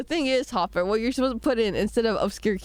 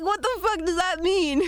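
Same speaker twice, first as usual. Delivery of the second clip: high, incredulous outburst; playfully shocked new mum; baffled mock outrage; 8 semitones higher than usual. Imagined parent reacting.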